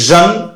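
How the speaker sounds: জন with a voiced, buzzing zh; SION said "zhon". Shown as a demonstration. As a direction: say জন as "zhon", not "shon". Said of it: "এসআইওএন হলে জন উচ্চারণ হবে". (জন, ঘোষ "ঝ়" দিয়ে উচ্চারণ)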